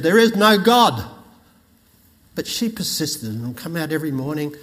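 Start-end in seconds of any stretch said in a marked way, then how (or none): 0.0-1.0 loud, higher retort; atheist yelling. Retold or imitated. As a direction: shout it over the fence. Atheist neighbour shouting back.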